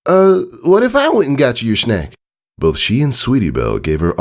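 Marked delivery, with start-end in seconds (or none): 0.1-2.1 awkward offer. A narrator's reading. Apple Bloom awkwardly offering.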